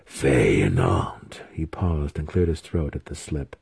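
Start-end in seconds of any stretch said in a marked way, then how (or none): 0.1-1.4 low, drawn-out spell chant; trance incantation. A narrator's reading. Wizard begins an incantation in deep trance, solemnly chanting. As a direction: intone it very slowly and deeply. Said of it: "slowly sunk into his deepest meditative trance".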